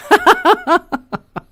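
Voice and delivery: high squealing fake laugh; hammed-up giggle; high put-on cackle. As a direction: let out a silly high cackle. Silly laugh after mock rant.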